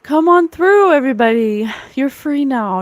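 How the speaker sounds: joyful loud summons; calling out. Acted. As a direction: shout the souls through the portal. Calling the spirits through portal.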